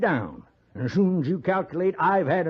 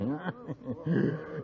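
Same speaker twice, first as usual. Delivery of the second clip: loose tipsy chuckle; drunken laugh; 8 dB quieter than usual. Acted. Drunk man laughing at accusation.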